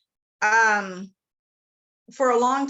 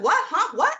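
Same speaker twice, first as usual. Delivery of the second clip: mock confusion; 9 semitones higher, 5 dB louder than usual. Confused reply to accent questions.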